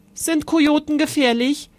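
put-on little boy voice. A narrator's reading. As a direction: ask like a small worried boy. Little brother asks anxiously.

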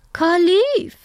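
high, loud aunt with a rising tune; aunt's voice. A narrator's reading. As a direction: announce the name high, lifting at the end. Aunt tells the bird's name.

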